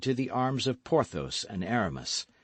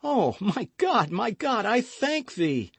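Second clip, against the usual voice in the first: fervent thanks; 11 semitones higher than usual. Acted. D'Artagnan thanking God in relief.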